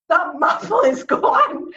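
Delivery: angry father, laughing. Retold or imitated. Father telling daughter the muffler is gone, laughing outrage. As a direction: hammer each word like an outraged dad. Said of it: "He said".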